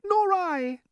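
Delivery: high, dainty ladybird voice. Acted. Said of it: "the ladybird said".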